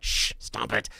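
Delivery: whispered hush; parent shushing. Retold or imitated. Parents hushing the laughing son in the audience, whisper. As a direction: hush the giggling kid. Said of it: "my parents are like".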